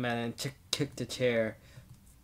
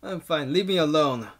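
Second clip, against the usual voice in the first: raised voice, snapping defensively; acted shout; 3 semitones higher, 8 dB louder than usual. Character wants the servant to leave, defensive shout. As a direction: push away with a burst of volume. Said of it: "I shout that".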